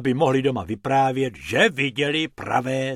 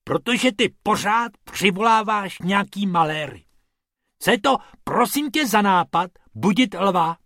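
high, exasperated telling-off; indignant, raised-pitch reproach; scolding; 7 semitones higher, 3 dB louder than usual. Acted. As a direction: tell off your friend. Scolding friend after narrow escape.

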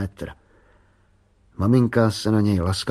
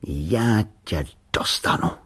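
slow, menacing threat; drawn-out menace; dangerous voice; slower than usual. A narrator's reading. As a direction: say it slowly, like a dangerous foe. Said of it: "řekl nebezpečným hlasem".

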